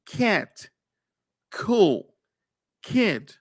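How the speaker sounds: put-on CAT, COOL, KID each with a breathy puff after the K; aspirated English K. Shown as a demonstration. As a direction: say each word apart, K released with an H. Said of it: "una K a la que le estamos añadiendo".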